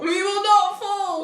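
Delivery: high, slow mock battle cry; echoed rallying cry. Co-host echoes the rally, mock-heroic cry.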